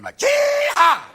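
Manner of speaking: jihad stretched slow, high and loud like a country yodel; country western drawl. Shown as a demonstration. Comic showing jihad as a country term, drawled high. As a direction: sing jihad out like a western twang. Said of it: "It sounds like a country western term".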